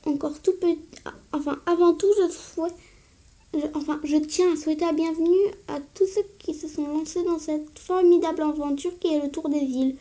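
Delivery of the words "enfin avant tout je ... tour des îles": usual speaking voice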